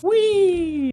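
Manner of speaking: very high squeal of fun; delighted little cheer; gleeful whee. Real, not acted. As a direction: let out a giddy high whee. Gleeful yelp while drawing.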